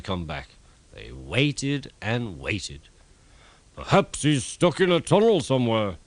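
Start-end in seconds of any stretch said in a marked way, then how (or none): 3.7-6.0 raised, loud fretting engine voice; a big engine guessing nervously; Gordon. A narrator's reading. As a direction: worry aloud about Thomas. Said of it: "suggested Gordon".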